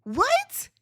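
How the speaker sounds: high, disbelieving questions; can't believe what she just heard; incredulous. Real, not acted. Host questioning why Angel sees Giselle as a big sister, high incredulous.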